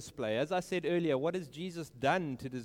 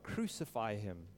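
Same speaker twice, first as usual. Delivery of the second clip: low and soft, a muted mock cry; a restrained, quiet crowd chant; hushed retelling of a cry; 8 semitones lower, 6 dB quieter than usual. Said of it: "they cried out".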